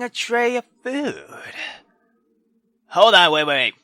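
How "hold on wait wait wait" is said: ordinary talk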